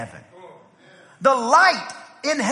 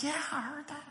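very soft, higher-pitched imitation of wife; imitating a wife's hushed answer; hushed reply; 4 semitones higher, 16 dB quieter, faster than usual. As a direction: answer quietly, voice raised in pitch. Retold wife's matter-of-fact nighttime reply.

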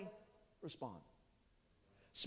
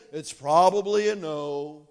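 lower, slow mopey voice; glum doubting kid; 9 semitones lower, 6 dB louder, slower than usual. Retold or imitated. Kids asking their dad, low and mopey. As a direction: sound like you expect a no. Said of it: "they say".

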